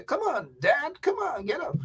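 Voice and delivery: lightly voiced daughter urging dad up; imitating daughter. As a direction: coax an old dad up. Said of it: "Emma is gonna be pulling me out and say".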